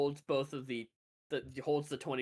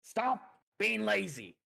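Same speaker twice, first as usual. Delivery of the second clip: raised, higher drawn-out command; barking orders; 7 semitones higher, 4 dB louder, slower than usual. Acted. Minotaur overseer to camp workers, barking orders. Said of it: "kind of barking orders at people in the camp".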